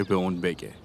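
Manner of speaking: usual speaking voice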